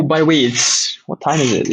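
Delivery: ordinary talk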